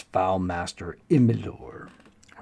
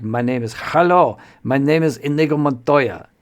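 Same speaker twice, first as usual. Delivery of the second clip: loud, exaggerated Spanish-accented declaration; hammed-up Mediterranean accent, climbing higher and louder; Inigo Montoya; 5 semitones higher, 8 dB louder than usual. Game master sets up a corny joke, exaggerated Spanish accent. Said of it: "exaggerated, like, uh, Inigo Montoya".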